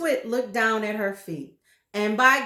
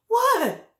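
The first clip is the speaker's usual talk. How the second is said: much higher, louder frightened gasp; 13 semitones higher, 8 dB louder than usual. Acted. Frightened wife asking what happens, high gasp. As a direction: gasp in terror. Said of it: "gasped Missus Twit".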